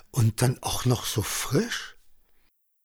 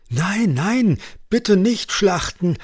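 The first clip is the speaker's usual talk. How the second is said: high, panicked plea; urgent, high-pitched protest; alarmed outburst; 9 semitones higher, 3 dB louder, slower than usual. A narrator's reading. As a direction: blurt it out in alarm, begging them to stop. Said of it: "stieß Johannes aus".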